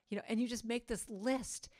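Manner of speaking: normal talking voice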